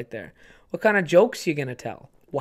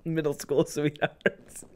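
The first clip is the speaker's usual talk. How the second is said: ordinary delivery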